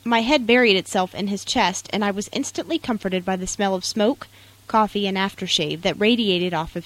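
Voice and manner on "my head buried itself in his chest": usual speaking voice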